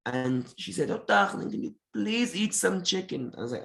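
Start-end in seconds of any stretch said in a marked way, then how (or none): 0.9-3.3 lightly imitated Egyptian accent, higher; a hint of a doting Egyptian grandma's coaxing; Egyptian grandmother accent. Psychic neighbour speaking as dead grandmother, mild Egyptian accent. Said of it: "started talking in this weird accent".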